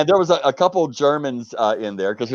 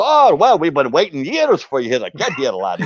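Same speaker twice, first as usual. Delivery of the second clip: Scottish welcome; 4 semitones higher than usual. Retold or imitated. Manager welcoming the long-awaited American, Scottish accent. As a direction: greet him like a long-lost friend, Scots style.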